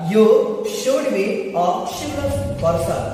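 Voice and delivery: very slow, careful sentence for learners; slow example read. Shown as a demonstration. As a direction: read it out word by word. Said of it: "क्या कहता है?". (उदाहरण वाक्य बहुत धीरे पढ़िए)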